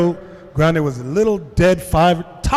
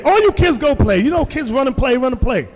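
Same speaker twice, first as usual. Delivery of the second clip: calling out; 7 semitones higher than usual. Retold or imitated. Calling out to kids to play.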